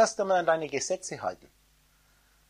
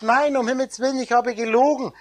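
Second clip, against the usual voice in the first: higher, louder pleading outcry; mother's cry; 6 semitones higher, 7 dB louder than usual. Retold or imitated. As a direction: cry out to save the child. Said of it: "schreit eine Frau auf und sagt".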